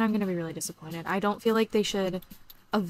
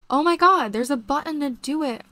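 much higher and louder burst of delight; excited squeal; 6 semitones higher, 7 dB louder than usual. Real, not acted. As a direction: shriek with joy at the discovery. Discovers new game button.

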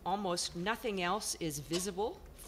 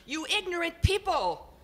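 high, loud, exasperated portrait voice; exasperated bellow; 6 semitones higher, 6 dB louder than usual. Acted. Portrait on the wall bellows at the family, exasperated. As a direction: bellow it out, fed up with ignorant people. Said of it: "a voice that could only be described as exasperated, practically a bellow".

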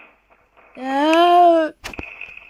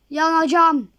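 usual speaking voice

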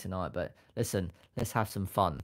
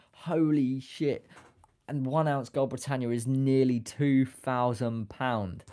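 higher, drawn-out astonishment; real amazement, slow and raised; amazed; 4 semitones higher, 4 dB louder, slower than usual. Real, not acted. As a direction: stretch it out, stunned by the price. Amazed at the gold price.

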